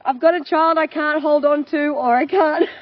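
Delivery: loud, high, flustered wail; mock-frantic overwhelmed cry; overwhelmed mum. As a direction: cry out, totally overloaded.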